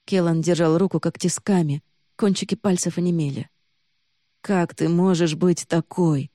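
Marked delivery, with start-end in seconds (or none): none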